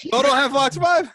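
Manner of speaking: high-pitched mock disbelief, imitating a friend; Joshua. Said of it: "Anytime Joshua talks about the Fox channel".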